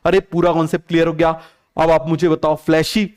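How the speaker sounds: ordinary talk, nothing marked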